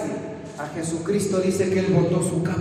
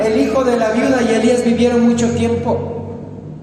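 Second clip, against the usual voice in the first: high, loud preacher's proclamation; raised, emphatic sermon delivery; forceful preaching; 8 semitones higher, 5 dB louder than usual. Acted.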